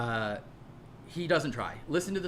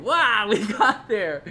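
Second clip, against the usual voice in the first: laughing; 5 semitones higher, 9 dB louder, much slower than usual. Real, not acted. Sarcastic aside at own point.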